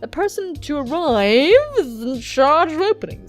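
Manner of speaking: loud, high, slow swooping delivery; theatrical boss laying down rules; hammed-up boss. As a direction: stretch the instructions out with exaggerated emphasis. Acted boss giving instructions.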